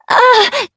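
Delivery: sharp high scream of pain. A narrator's reading. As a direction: cry out in sudden agony. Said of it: "she screamed".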